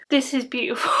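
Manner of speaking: half laugh, half sob over something beautiful; real crying through a laugh. Real, not acted. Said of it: "Why am I crying?".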